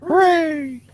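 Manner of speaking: high, excited cheer. Real, not acted. Cheering a hit.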